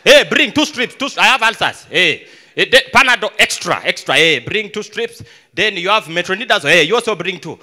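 bold, loud ordering at the counter; loud, confident customer. Acted. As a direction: call out the order for all to hear. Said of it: "When you're buying other drugs".

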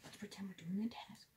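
conspiratorial whisper. Acted. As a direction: whisper the plan so nobody hears. Imposters plotting in secret.

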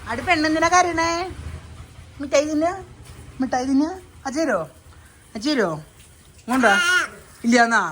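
sing-song coaxing to stop her tears. Real, not acted. Father coaxing a crying toddler. (ഉയർന്ന സ്വരത്തിൽ കുഞ്ഞിനോടുള്ള കൊഞ്ചൽ)

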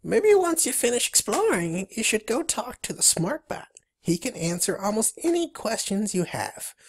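high cartoon beaver voice; Billy. Beaver pointing newcomer onward, high critter voice. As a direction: pitch it up like a little critter. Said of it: "states Billy".

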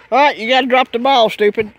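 loud, fast shout at the dog; calling the dog. Real, not acted. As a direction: holler at the dog to drop it. Yelling at dog.

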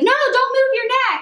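a sharp, high, loud warning to stay still; urgent shout. Retold or imitated. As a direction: shout it in alarm at someone who must not move. Everyone shouting at injured narrator.

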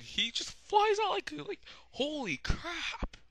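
very high squealed panic; mock shock. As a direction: squeal it like someone shooting out of scalding water. Mimics scalded ninja shooting out of water.

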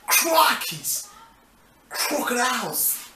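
hammed-up Aussie exclamations; single words punched out in broad Australian; Australian accent. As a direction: Steve Irwin-style Australian accent.

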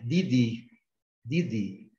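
"Didi" with both Ds hard, northeastern Brazilian style. Shown as a demonstration. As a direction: keep "Didi" free of any "dji" sound. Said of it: "usar aquele sotaque nordestino".

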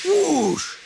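high steam-engine hiss; hissing whoosh. A narrator's reading. Tram engine hissing at being called electric, sharp whoosh. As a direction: let out an indignant rush of steam. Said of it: "hissed Toby".

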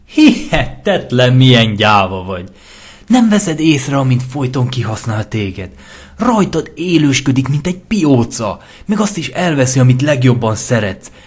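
high, loud contempt; mock her cowardice loudly; scornful taunting. Lentó scorns hesitant Lyra, high loud contempt.

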